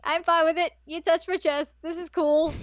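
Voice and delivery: imitating the smitten boy, delighted. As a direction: play it cool but thrilled. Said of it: "he's like".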